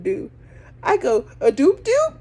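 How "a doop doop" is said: very high, loud mouth-beep "doop doop"; fake car-lock beep. Driver faking the lock chirp for onlookers, high and loud. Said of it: "I don't know why I made the sound".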